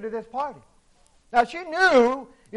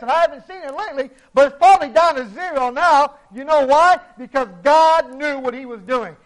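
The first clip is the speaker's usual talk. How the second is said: louder, quicker preaching build; preaching at full force; 2 semitones higher, 4 dB louder than usual. Acted. Preacher mocking the claim about useless body parts, building preaching. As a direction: push it up toward the punchline.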